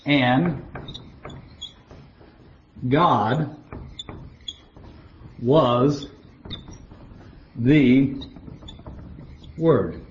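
one word at a time, long pauses between; halting, deliberate word-by-word delivery; word-for-word reading. Shown as a demonstration. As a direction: spell out a literal translation slowly, isolating every word. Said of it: "So in the Greek it says".

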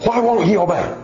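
high-pitched plaintive lament; acted frustrated whine; exasperated mother. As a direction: wail it like a worn-out mom.

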